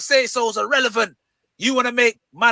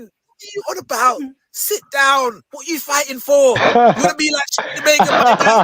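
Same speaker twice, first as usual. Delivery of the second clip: higher, louder mock telling-off; mocking scolding; 3 semitones higher, 3 dB louder than usual. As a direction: scoff at him in a raised voice. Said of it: "And you're thinking".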